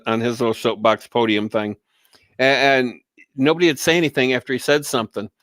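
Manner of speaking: ordinary talk, nothing marked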